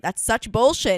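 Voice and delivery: indignant re-enacted outburst; retold yell. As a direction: yell it at the officer as you retell. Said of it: "I did yell it at the officer".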